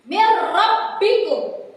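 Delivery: Mir rabbikum, the n merged into a doubled r, no nasal hum; slow, clear recitation without dengung; correct idgham bila gunah. Shown as a demonstration. Teacher recites Mir rabbikum for the class, slow and clear. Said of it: "Contohnya seperti ini, perhatikan".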